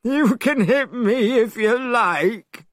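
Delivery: high, slow sulky pig voice; Pigsy complaining. A narrator's reading. Pigsy sulking to Monkey after a failed change, high and whiny. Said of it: "complained Pigsy".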